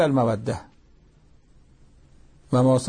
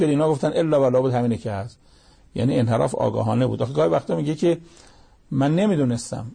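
ordinary delivery

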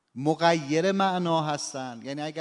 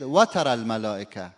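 و ترى الملائکة with the stress moved onto و, louder; shifted accent; 4 semitones lower, 6 dB louder than usual. Shown as a demonstration. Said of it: "حالا اگر که بگید".

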